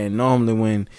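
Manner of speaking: usual speaking voice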